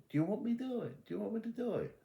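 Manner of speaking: high-pitched pleading; retold high, anxious question; alarmed protest. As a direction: pitch it way up, aghast.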